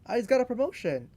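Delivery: mock-excited promotion news. Shown as a demonstration. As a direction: play the friend bursting with news.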